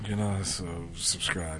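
low, soft sultry voice; sexy voice. Acted. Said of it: "why you put your sexy voice on?".

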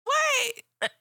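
high, loud, shocked exclamation; outraged disbelief. Retold or imitated. Mom retelling her shocked reaction to the boss hanging up, loud and high. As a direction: throw it up high in total disbelief.

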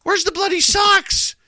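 high, loud mock indignation; mock demand. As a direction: yell it up high in mock outrage. Kids demanding socks from mom.

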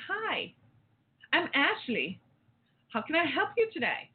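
mock-cheerful teller voice; chirpy robot teller. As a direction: greet like an overly friendly bank clerk. Robot bank teller greeting.

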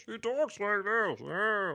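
a squeaky, drawn-out kid's cartoon cat; Cat's voice. Podcast host imitating Cat from Peg Plus Cat, high and slow. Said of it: "the voice of Cat is hilarious".

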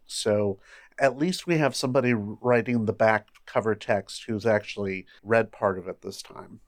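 ordinary delivery

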